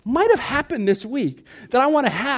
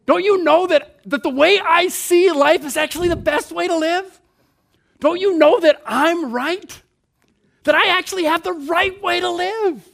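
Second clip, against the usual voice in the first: higher, louder self-righteous insisting; hammed-up voice of someone sure they're right; mock know-it-all; 5 semitones higher, 4 dB louder than usual. Preacher mocking his own insistent self. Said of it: "I go".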